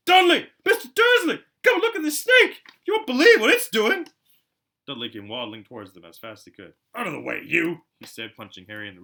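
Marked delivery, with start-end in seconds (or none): 0.1-4.1 much higher, very loud excited yell; deafening shout. A narrator's reading. Piers shouts for Dudley and Mr Dursley to come look. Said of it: "A deafening shout". 6.9-7.8 louder, higher bully's snap; rude shove-off. Acted. Dudley shoves Harry out of the way.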